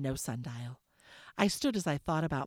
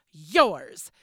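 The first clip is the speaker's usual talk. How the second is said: indignant retort; 12 dB louder than usual. Acted. Isabel retorts in quarrel.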